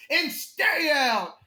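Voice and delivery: slow, stretched mock bellow; retold bar-owner shout. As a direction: shout it after someone being thrown out. Retold bar owner throwing man out.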